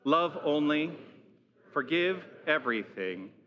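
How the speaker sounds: slow, measured group recitation; reciting out loud. Real, not acted. Minister leading the congregation in the mission statement, slow recitation. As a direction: say each phrase deliberately, pausing for all to join. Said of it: "let's say out loud these words".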